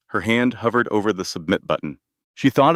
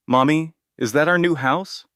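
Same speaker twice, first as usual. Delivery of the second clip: put-on child voice; 8 semitones higher than usual. Child asks about new house.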